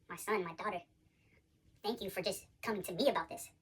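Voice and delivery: tender God's voice. Retold or imitated. God speaking tenderly to the sinner.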